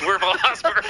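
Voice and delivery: high, laughing through the words. Real, not acted. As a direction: burst out laughing as you echo it. Laughing while repeating friend's quip.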